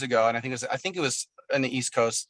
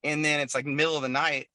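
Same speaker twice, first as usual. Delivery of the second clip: normal talking voice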